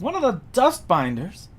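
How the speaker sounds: high, loud questioning guard voice; guardsman. Acted. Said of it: "some guardsmen yelling out".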